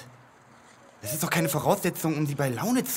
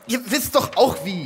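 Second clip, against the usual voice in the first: indignant outburst; 7 semitones higher, 6 dB louder than usual. Acted. Defensive outburst.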